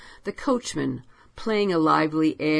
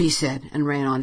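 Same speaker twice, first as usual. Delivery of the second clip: usual speaking voice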